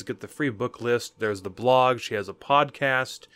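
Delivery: ordinary talk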